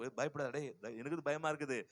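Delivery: low, soft mock pep talk to oneself; acted under-the-breath fear; hushed nervous self-talk. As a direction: mutter it quietly, pretending to be brave. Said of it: "at least நடிக்கவோ செய்யணும்".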